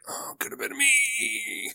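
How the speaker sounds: high, soft, drawn-out mock lament; wistful whine. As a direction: pine after the missed jackpot in a small high voice. Voicing wistful gambler missing out.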